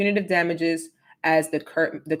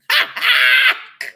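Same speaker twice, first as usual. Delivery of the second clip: shrieking high laugh; high burst of laughter; 8 dB louder than usual. Real, not acted. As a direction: cackle out loud at the chat. Reacts to chat joke.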